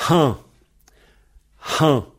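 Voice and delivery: "Han" with a strongly blown H; H bien soufflé. Shown as a demonstration. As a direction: puff air out at the start of "Han". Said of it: "Essayez de bien souffler le H". (« Han » avec un H fortement soufflé)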